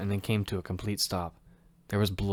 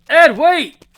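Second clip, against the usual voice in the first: very high, very loud yell of warning; 19 dB louder than usual. Acted. Narrator yelling at Ed to stop running, full loud yell. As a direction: scream his name to stop him. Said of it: "I saw him and yelled".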